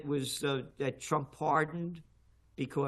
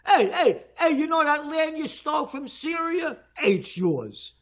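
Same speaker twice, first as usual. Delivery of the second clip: high, loud mocking Trump voice, shouting; hammed-up Trump taunting a donor, much higher and louder; 10 semitones higher, 5 dB louder than usual.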